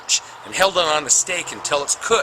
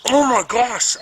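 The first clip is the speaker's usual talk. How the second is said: shocked exclamation; 10 semitones higher, 5 dB louder, slower than usual. Retold or imitated.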